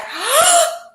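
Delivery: sharp loud gasp of delight; loud excited gasp. Retold or imitated. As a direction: gasp like you just met a celebrity. Excited meeting of namesake.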